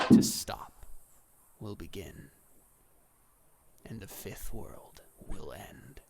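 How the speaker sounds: breathy reaper, drawing out each phrase; ominous, solemn Death. Acted. Voices Death foretelling the end.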